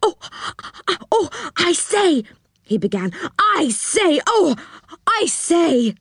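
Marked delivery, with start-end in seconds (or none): 0.0-2.2 high-pitched, flustered excitement; excited little man, voice pitched right up. A narrator's reading. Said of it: "suddenly got very excited". 3.4-5.9 high-pitched, flustered excitement; excited little man, voice pitched right up. A narrator's reading. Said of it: "suddenly got very excited".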